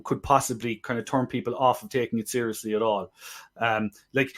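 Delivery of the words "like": ordinary talk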